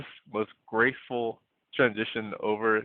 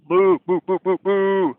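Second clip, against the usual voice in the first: loud mock cow mooing; cow moos; 8 semitones higher, 6 dB louder than usual. Shown as a demonstration. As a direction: moo away like a cow. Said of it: "Take your animal noise here".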